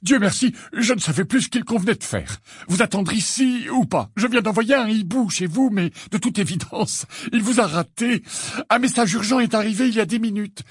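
put-on high, jittery, anxious old man; feverish old wizard. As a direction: fret out every word like a nervous old clerk. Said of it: "d'une voix fébrile".

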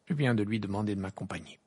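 ordinary delivery, nothing marked

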